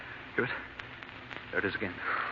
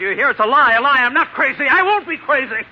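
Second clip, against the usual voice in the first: climbing higher and louder with every denial; hysterical shouting; 9 semitones higher, 7 dB louder than usual. Acted. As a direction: scream the denials as panic takes over. Accused of madness, hysterical denial.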